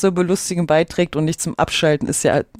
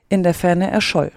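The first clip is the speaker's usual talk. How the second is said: plain reading voice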